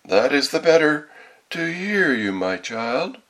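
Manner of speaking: high mock-granny, starting loud; wolf posing as grandmother. A narrator's reading. Wolf explaining its ears to the girl, fake granny voice.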